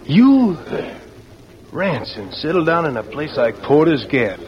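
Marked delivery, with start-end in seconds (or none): 0.0-0.6 a sharp, high, loud "You?"; can't believe what he just heard; stunned disbelief. Acted. Buck to Ransom, shocked he'd settle down.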